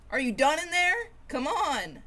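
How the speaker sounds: high, loud, impatient call through a door. Acted. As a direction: hurry them up from outside the door. Impatient sibling at bathroom.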